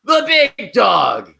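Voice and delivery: high, shouted announcer catchphrase; mock commentator shout; Michael Cole impression. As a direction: bellow it like a ringside commentator, stretched out. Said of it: "no more Michael Cole going".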